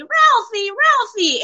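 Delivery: very high, drawn-out mimicked call; high kid's call. Fan re-enacting a film scene, high imitated kid's call. Mimics a movie kid calling Ralphie.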